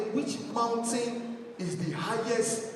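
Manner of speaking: slow, deliberate quiz question; slow teacher question. Retold or imitated. Teacher quizzing the girl, slow and deliberate. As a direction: ask it slowly like a teacher.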